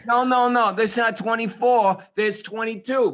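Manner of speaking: loud, high-pitched protest; excited joke telling. Pretend. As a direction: jump in with hammed-up insistence.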